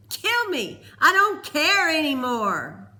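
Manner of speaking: loud, high, desperate yell; desperate shout. A narrator's reading. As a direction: scream it, past caring. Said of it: "he taunted".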